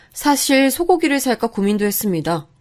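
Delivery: ordinary delivery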